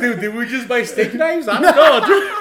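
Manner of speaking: loud, high, bewildered protest; mock panic, laughing. Joking about buying steak knives, laughing mock panic. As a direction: cry it out through laughter like a baffled bystander. Acted confusion, laughing at the memory.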